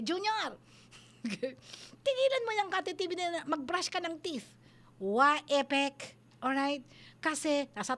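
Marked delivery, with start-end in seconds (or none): 0.1-4.5 high-pitched mock yell from afar; pretend parental shout. Parent calling to Junior from elsewhere to brush teeth, mock shouting. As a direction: call out to the child in a raised pitch, half in play. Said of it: "Tapos sumigaw ka, kunwari".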